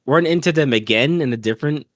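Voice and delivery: normal talking voice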